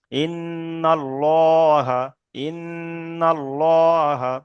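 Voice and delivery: "ইন্নাল্লাহা" drawn out very slowly with a heavy "llo"; thick L recitation. Shown as a demonstration. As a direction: chant "ইন্নাল্লাহা", holding the n and the rounded L. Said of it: "ল করে পড়তে হবে". ("ইন্নাল্লাহা" টেনে, পুর ল দিয়ে তিলাওয়াত)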